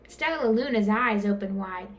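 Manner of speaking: ordinary delivery, nothing marked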